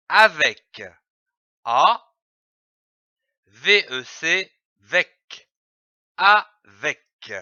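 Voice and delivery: avec spelled A, V E C, then said whole; syllables sounded out. Shown as a demonstration. Teacher spelling avec for a child. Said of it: "Commençons par lire les syllabes".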